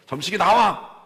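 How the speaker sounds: loud, high call at the door; mother yelling. Retold or imitated. Mother shouting outside the bully's house, loud and high. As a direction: bellow the boy's name to draw him out.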